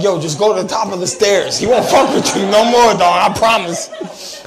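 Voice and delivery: loud, high-pitched hammed-up urging; mock urgent advice. Comic advising Magneto on beating X-Men's leader, mock urgent shout. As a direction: holler advice like a fired-up buddy. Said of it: "I'm like".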